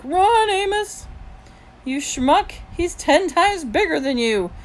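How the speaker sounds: high, loud, drawn-out shout; reenacted panicked yell. A narrator's reading. Boy retelling the fight to friends, reenacted yell. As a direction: holler a warning and scolding at your friend.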